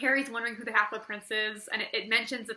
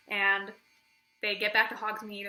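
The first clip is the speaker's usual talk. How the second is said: normal talking voice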